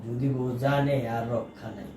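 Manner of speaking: low, hushed, slow worried aside; muttering to himself. Acted. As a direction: think aloud under your breath, out of the wife's hearing. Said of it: "মনে মনে কি কথা কও". (মনে মনে বিড়বিড় করে)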